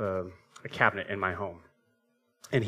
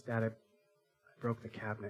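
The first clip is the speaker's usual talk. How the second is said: put-on small, halting child's admission; soft, slow owning-up to Dad; guilty confession; 9 dB quieter than usual. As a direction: voice a sheepish kid confessing.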